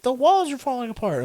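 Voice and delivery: high, frantic imitation of mom; panicked mom. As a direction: squeal it like a panicking mother. Mom freaking out in dream.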